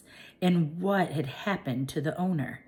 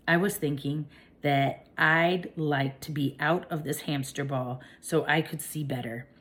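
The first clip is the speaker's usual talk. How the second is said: ordinary delivery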